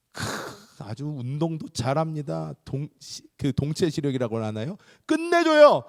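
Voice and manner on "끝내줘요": high, loud exclamation; preacher praising hunter Esau, excited; exclaiming. Real, not acted. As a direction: burst out with admiration.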